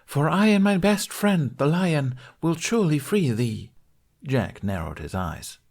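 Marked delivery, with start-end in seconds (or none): 0.1-3.7 high theatrical knight's vow; heroic declaration. A narrator's reading. Knight promises Lunette rescue, declaring in a high voice. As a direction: proclaim it grandly, voice pitched up. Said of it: "Tooth declared".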